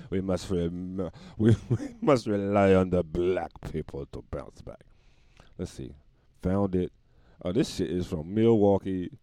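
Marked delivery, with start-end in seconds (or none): 0.1-4.8 solemn mock German, breaking for a chuckle; mock German accent. German brewer plotting a comeback, mock German accent.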